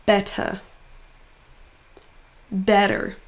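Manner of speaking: BET in "Better" hit firmly; stressed syllable emphasized. Shown as a demonstration. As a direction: punch the first syllable of "Better". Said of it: "en prononçant plus fort la syllabe". (première syllabe de « Better » appuyée)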